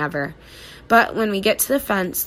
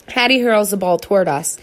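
ordinary delivery

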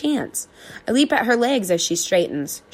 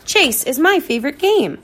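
happy chase; 8 semitones higher, 3 dB louder than usual. A narrator's reading. Dog chasing.

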